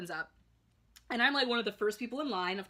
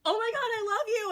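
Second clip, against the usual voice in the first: high, loud starstruck squeal; freaking-out fangirl; 13 semitones higher, 7 dB louder than usual. Retold or imitated. Fan to James Saint James walking by, freaking-out squeal. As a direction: squeal it out at your idol. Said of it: "freaking out".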